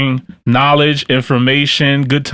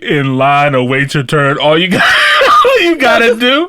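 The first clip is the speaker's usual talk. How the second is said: laughing; 10 semitones higher than usual. Real, not acted. Host cracks up mid-sentence.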